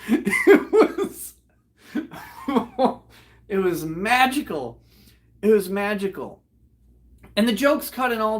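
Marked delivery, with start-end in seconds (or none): 0.1-3.0 high words lost in laughter; real laughing fit. Real, not acted. Teller too amused to finish the sentence, real laughing fit. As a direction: try to speak and dissolve laughing. Cracks up retelling it.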